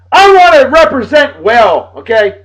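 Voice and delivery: loud, high, slow holler; proud hyped shout. Real, not acted. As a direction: shout it out for the home island.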